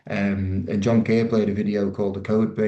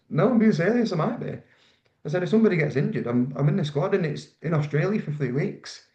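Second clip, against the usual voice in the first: higher, giddy retelling of the big news; excited disbelief; 8 semitones higher than usual. Retold or imitated. Telling his partner he might make the World Cup, excited. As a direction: insist it's true, thrilled and high.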